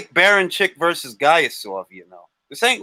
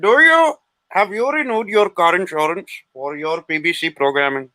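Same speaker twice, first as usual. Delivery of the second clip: mock Indian telemarketer accent; Indian call-centre accent; 2 semitones higher than usual. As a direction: imitate a scripted phone seller in an Indian accent. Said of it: "imitating the phone calls that I get".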